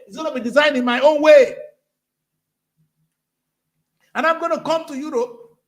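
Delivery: loud, high preacher's exhortation; fiery preaching. Acted. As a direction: rouse the congregation, full voice. Preaching rant at full force.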